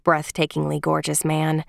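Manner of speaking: ordinary delivery, nothing marked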